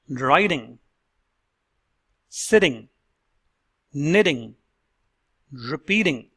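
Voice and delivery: "sitting", "knitting", "repeating" with T as a quick D; slow, careful model words; flapped T model words. Shown as a demonstration. Coach demonstrating the fast D in -ting words. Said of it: "Let's listen to the words".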